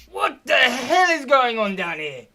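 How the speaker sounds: furious loud, high bellow; storming-in yell. Acted. Guard comes storming down the stairs, angry yell. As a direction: roar it as you storm in. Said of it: "comes storming s-, down the stairs".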